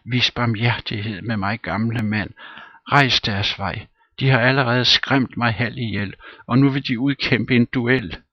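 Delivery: high, loud begging; hammed-up kneeling plea; pleading on his knees. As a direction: implore the master, frightened. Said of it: "falder på knæ".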